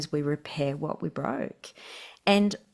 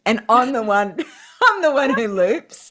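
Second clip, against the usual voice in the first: higher and louder, laughing through the words; laughing confession; 6 semitones higher, 8 dB louder than usual. Real, not acted. Describing herself next to her steady husband, laughing. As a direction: giggle as you own it.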